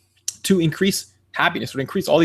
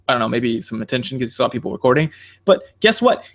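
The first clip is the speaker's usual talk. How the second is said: ordinary talk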